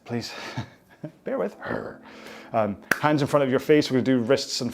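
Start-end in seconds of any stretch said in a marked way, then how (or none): 1.2-1.5 high, lilting repeat of Bear with; playful. Shown as a demonstration. Repeating Bear with to viewers in a teasing high voice.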